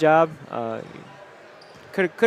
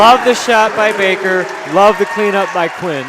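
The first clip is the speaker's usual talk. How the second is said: excited; 5 semitones higher, 8 dB louder than usual. Real, not acted. Analyst reacting to buzzer play.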